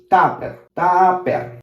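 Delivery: tupper as "TAH-per", unaspirated p and Spanish r. Shown as a demonstration. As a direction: pull tupper apart with a Spanish p.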